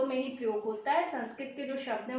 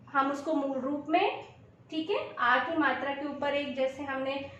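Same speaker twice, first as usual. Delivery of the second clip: normal talking voice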